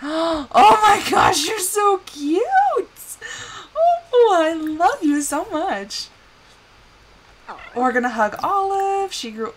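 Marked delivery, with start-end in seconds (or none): 0.0-6.1 high, gasping, squealed delight; gushing adoration. Real, not acted. Player adoring grown-up Olive, gushing. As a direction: coo and gush over something adorable.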